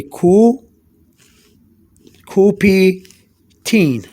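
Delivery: put-on "Coupe-tine" drawn out very slowly as koo, pee, teen; syllable-by-syllable pronunciation. Shown as a demonstration. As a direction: break "Coupe-tine" into syllables with long pauses. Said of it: "I say".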